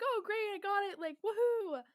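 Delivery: re-enacted delight; retold cheering. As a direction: cheer the jump on, ending in a whoop. Retelling cheering on the jump.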